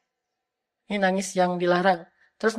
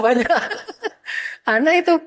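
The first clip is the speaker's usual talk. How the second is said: ordinary talk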